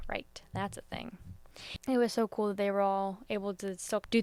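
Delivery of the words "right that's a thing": much softer, whispered; hushed realisation; whispered aside. Retold or imitated. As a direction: murmur it to yourself. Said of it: "I was like".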